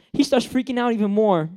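ordinary talk

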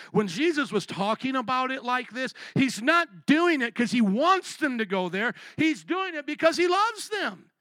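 high, loud pleading preacher cry; fiery preaching. Real, not acted. Preacher pleading with the church about the Pope. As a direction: press the point hard from the pulpit.

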